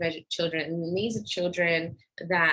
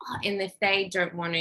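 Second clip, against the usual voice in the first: normal talking voice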